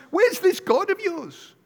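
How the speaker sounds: high, slow mocking jeer; sneering "where's your God" jibe; mocking taunt. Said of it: "He's mocking them".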